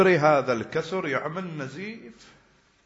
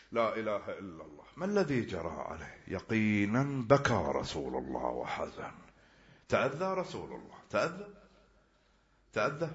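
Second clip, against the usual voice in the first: usual speaking voice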